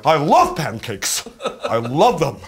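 Adam Driver. Acted. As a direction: lean hard on LOVE, too loud for the mic. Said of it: "deep dive on Adam Driver".

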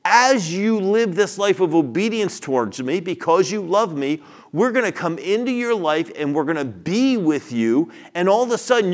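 emphatic preacher driving each phrase home; impassioned preaching. Real, not acted. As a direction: proclaim it forcefully to the congregation. Preacher expounds scripture at full force.

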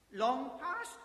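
put-on high, loud startled old man; startled question. Scrooge asks, startled.

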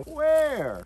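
high, loud, falling call; kids' show questioning. Shown as a demonstration. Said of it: "And then go".

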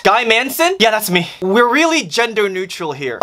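ordinary talk

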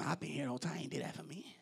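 whispered sulky muttering, very soft; elder brother whispering his grievance to himself; grumbling whisper. Acted. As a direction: grumble it under your breath like a jealous brother. Preacher voices grumbling elder brother.

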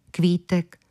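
ordinary delivery

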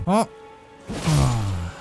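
normal talking voice

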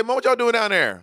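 high-pitched call, words kept distinct; corrected family call. Shown as a demonstration. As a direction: show how you really call Mom downstairs. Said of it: "I say".

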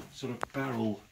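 ordinary delivery

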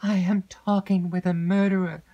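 high, loud, stricken realisation; horrified. A narrator's reading. Heroine realises she faces a murderer.

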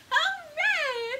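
high, loud hooray; joyful shouted hooray. Acted. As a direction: cheer it out for the kids. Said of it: "shout hooray".

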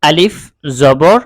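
loud, slow letter-by-letter spelling of Arabic; teacher's loud spelling chant; spelling drill. Shown as a demonstration. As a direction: call out each letter like a Quran teacher. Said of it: "এই উচ্চারণগুলো কিভাবে হবে, সেটাই আমি বলে দিচ্ছি".

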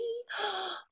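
high, sharp gasp; excited gasp. Acted. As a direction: gasp in delight. Suspense before reveal.

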